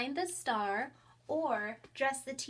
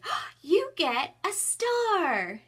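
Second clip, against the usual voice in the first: high, loud, slow teasing offer; mock delight; 7 semitones higher, 8 dB louder, much slower than usual. Shown as a demonstration. Teacher teasing a child with a fake star, higher, louder and slow. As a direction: drag it out so they know it's a trick. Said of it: "sometimes I'll say".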